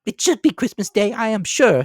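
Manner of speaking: high, loud, biting mother's voice; sarcastic wife. A narrator's reading. Wife retorting to her husband, high sarcastic voice. Mrs Cratchit's voice.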